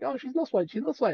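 high, panicky mock Mickey; imitating a flustered boyfriend, pitched high. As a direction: scramble for an excuse, high and flustered. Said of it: "and he's like".

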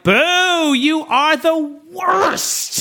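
high, slow mock booing. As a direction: boo the villain slowly.

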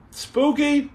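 "Spooky?" pitched high as a question. Pretend. Streamer toys with a viewer's word spooky, high questioning. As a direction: lift "Spooky" right up, playfully.

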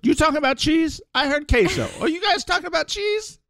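high-pitched, excited butting-in voice; eager eavesdropper impression. Turk catching one Spanish word, high excited voice. Acts out Scrubs character butting in.

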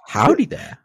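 high, rising howdy there; Obi-Wan style howdy. Pretend. Cohost tries the new catchphrase, high and rising. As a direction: greet like a Jedi cowboy. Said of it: "In an Obi-Wan accent".

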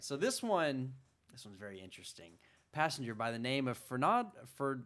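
normal talking voice

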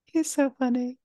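high, playful repeat; high silly voice. Pretend. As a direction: say it in a squeaky voice. Repeats line in put-on voice.

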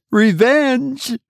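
high sharp cry of revenge; cried out. A narrator's reading. Wife turns on brother, a high fierce cry. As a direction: spit the word out high. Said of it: "cried the woman".

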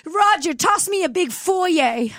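high, theatrical mock plea; scared mid-Atlantic accent. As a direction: implore like an old-film damsel. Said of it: "When she gets really scared".

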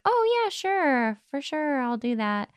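high, slow, overly polite yes; polite Midwestern. Retold or imitated. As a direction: force a polite, fading agreement. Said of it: "I might be polite and be like".

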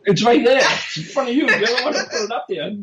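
high-pitched, exasperated pointing out; exasperated bickering. Real, not acted. Co-host arguing over where the laptop sits, exasperated. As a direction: protest the obvious, pitch jumping up.

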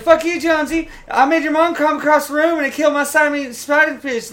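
very high, loud character squeal; Shorzy. Retold or imitated. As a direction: chirp a rival in a put-on high voice. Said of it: "He's like".

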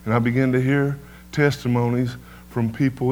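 normal talking voice

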